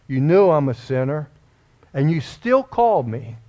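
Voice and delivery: rising, pleading prayer to God; impassioned prayer. Acted. Preacher praying aloud to God, fervent and rising. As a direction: cry out to God, building.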